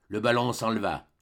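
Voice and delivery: ordinary delivery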